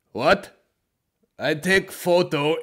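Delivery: put-on baffled man insisting in a heavy Eastern European accent. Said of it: "something about those Eastern Europeans".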